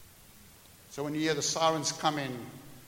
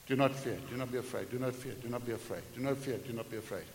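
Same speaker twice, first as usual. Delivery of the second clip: each repeat quieter and lower, almost under the breath; fading repetition; 4 semitones lower, 6 dB quieter, faster than usual. Shown as a demonstration. A preacher muttering scripture to himself, fading away. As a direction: let the words sink lower every time. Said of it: "repeated like muttering".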